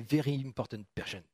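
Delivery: playful French-speaker's attempt at English accent. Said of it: "Toujours avec mon accent anglais".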